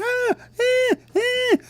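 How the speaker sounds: high squealed yelps of mock panic; scared bird cries. Scared bird runs off from the leaves, shrieking in panic. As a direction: yelp in falsetto like a frightened bird. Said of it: "This bird was so scared".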